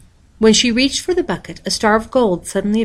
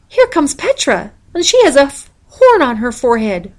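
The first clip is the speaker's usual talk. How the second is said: excited tattling; 7 semitones higher, 6 dB louder than usual. A narrator's reading. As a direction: blurt the news with glee. Girl excitedly announcing to mother.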